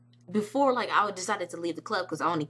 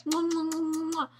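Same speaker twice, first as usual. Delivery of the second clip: rapid high "mwah" kissing noises; blown kisses; 9 semitones higher than usual. Retold or imitated. Fan blowing kisses to Kelly, high gushing smooches. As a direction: fire off smooches to an idol.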